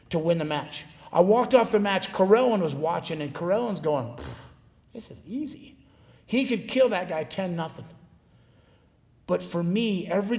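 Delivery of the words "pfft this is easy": dismissive scoff. Retold or imitated. Mocking rival's easy win.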